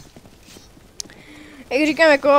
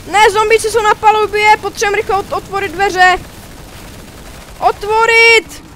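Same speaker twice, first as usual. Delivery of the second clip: higher, louder calling, last words slowed; urgent repeated yell; urgent shout; 7 semitones higher, 5 dB louder than usual. Real, not acted. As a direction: yell to open the doors. Urgent call in game.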